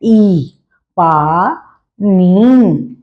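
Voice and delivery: "ई, पानी" with the ई sound then "पानी" dragged out very slowly; slow spelled-out reading. Shown as a demonstration. Teacher answering how the word is said, very slowly. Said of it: "तो हम इसे कैसे बोल रहे हैं?".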